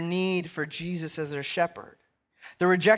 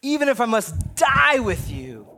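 slow, higher, loud declaration as Peter; emphatic vow; 7 semitones higher, 5 dB louder than usual. Retold or imitated. Peter vowing to Jesus he'd die first, slow loud emphatic cry. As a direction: proclaim it like an oath, drawn out and loud. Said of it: "responds to Jesus emphatically".

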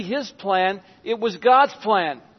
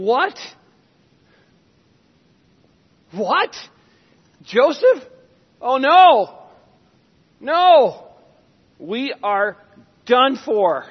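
higher and louder, in rising alarm; panicked brothers; 5 semitones higher, 7 dB louder, slower than usual. Acted. Brothers realising Joseph stands before them, panicked. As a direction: gasp it out like men who think they're finished.

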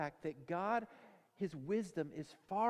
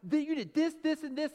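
high, loud list of charges; hammed-up scolding wife; mad wife; 10 semitones higher, 8 dB louder than usual. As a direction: rattle off grievances, acting angry. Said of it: "really mad at him".

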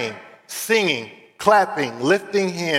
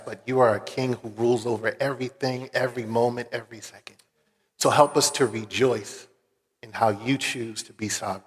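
earnest prayer; 3 semitones lower than usual. Real, not acted. Pastor praying over the congregation, hushed and reverent. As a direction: pray humbly over the people, unhurried.

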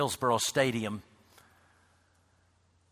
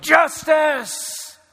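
high, loud answering shout; echoed yell; 9 dB louder than usual. Retold or imitated. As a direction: yell it back from across the crowd. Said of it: "Somebody else echoed".

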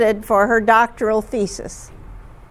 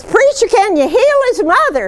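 much higher and louder, an eager country woman begging; pleading woman; 11 semitones higher, 5 dB louder than usual. Retold or imitated. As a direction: beg the preacher to heal the mother. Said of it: "And she says".

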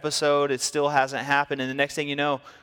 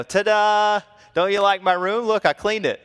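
higher, louder showman ta-da; fake cheerful presentation; 7 semitones higher, 5 dB louder than usual. Boy presenting faked clean room to mom, bright and loud.

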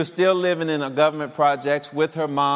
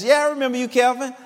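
put-on girlfriend's reply; 9 semitones higher, faster than usual. Voicing the girlfriend.

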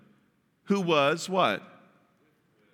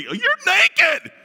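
much higher and louder, a startled exclaim; shocked discovery; 12 semitones higher, 7 dB louder than usual. Pretend. Adam and Eve suddenly seeing each other, exclaiming in shock. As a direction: blurt it out in shock.

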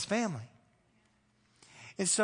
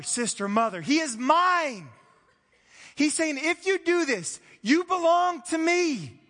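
loud, high, slow preaching of Jesus' words; forceful preaching; 5 semitones higher, 7 dB louder, slower than usual. Acted. Pastor proclaiming Jesus' words to the congregation, forceful preaching. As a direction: proclaim it like a call from the pulpit.

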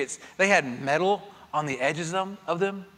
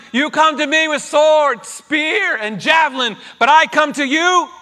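full loud shout, higher, every word hurled; bigger David yell; 9 semitones higher, 9 dB louder than usual. Acted. Preacher redoing David's cry to Goliath, a full loud shout. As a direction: belt it out as David charging the giant. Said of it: "we gotta work on that".

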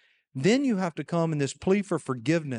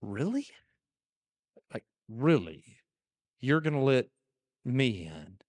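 disbelieving thief; 2 semitones lower than usual. Retold or imitated. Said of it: "if he even had the faith to go".

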